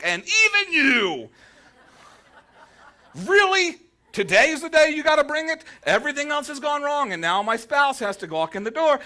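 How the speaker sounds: you too, really, today of all days; exasperated outburst. Pretend. Spouse starts a fight, you yell back in exasperation.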